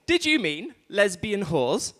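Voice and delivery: slow, swooping assistant intonation; phone voice assistant. Acted. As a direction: mishear it as a phone assistant, slowly. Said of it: "it came back, it said".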